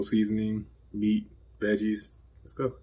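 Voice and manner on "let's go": ordinary talk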